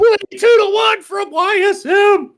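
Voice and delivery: high, loud shout of disbelief; excited shout. Real, not acted. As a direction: yell it out, stunned. Shocked by longshot winner.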